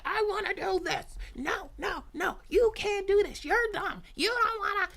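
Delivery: high, loud whiny tantrum voice; bratty gremlin. Acted. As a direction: shout it like a spoiled child. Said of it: "They be like".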